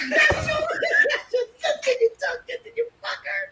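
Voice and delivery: very high, squealed scolding through laughter; laughing, hammed-up outraged squeal; mock fury, laughing. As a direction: rant in mock anger while cracking up. Acts out furious character, laughing.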